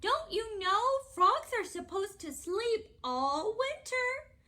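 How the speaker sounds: high, loud motherly call; high-pitched character mother; frog mother. A narrator's reading. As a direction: voice a storybook mom sending her child to bed. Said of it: "said his mother".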